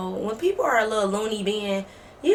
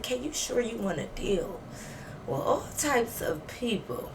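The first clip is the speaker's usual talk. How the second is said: talking to self; 8 dB quieter than usual. Retold or imitated. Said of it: "I said".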